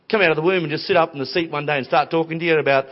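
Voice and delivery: ordinary talk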